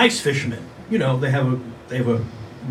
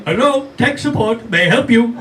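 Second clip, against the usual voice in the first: mock tech support; 6 semitones higher than usual. He answers the robocaller.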